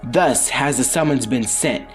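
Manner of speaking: plain reading voice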